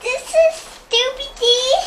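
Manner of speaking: high squeal of delight; thrilled at the present; delighted opening a present. Real, not acted. Child opening Scooby-Doo shoes.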